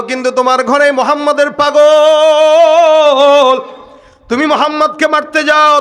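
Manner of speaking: high, ringing preacher's taunt; loud taunting challenge. Retold or imitated. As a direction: hurl it at him, stretching the last word. Acted taunt of Omar in sermon.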